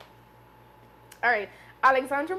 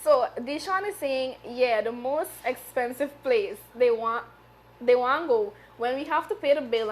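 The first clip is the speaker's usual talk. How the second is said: normal talking voice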